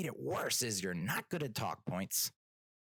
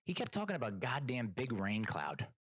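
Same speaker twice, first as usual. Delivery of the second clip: normal talking voice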